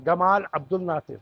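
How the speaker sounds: گمال عبد الناصر with a hard g, like گاف; Egyptian g for j. Shown as a demonstration. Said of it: "جیم کو گاف پڑھتے ہیں".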